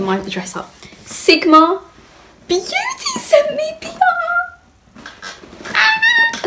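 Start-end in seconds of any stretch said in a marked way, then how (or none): 1.0-5.1 rising into a very high tearful squeal; overwhelmed, near tears. Real, not acted. Vlogger announcing her first big brand parcel, overwhelmed squeal. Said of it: "I'm actually gonna cry". 5.7-6.5 very high-pitched squeal; excited squeal. Real, not acted. Vlogger overcome by a brand parcel, excited squeal.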